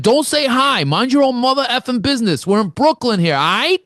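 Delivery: loud, high, hostile street challenge; hammed-up Brooklyn tough guy snapping at a stranger; angry Brooklyn guy. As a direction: bark it like a tough guy picking a fight. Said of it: "He's like".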